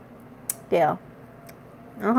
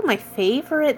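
usual speaking voice

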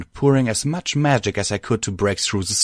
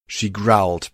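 ordinary delivery

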